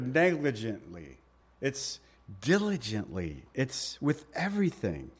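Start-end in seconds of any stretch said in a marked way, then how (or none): 2.4-3.4 diligently pressed down low and soft; stressed word. Shown as a demonstration. Preacher stressing the key word to the congregation, diligently low and weighty. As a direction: lean into diligently, quiet and heavy. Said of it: "stress that word diligently enough".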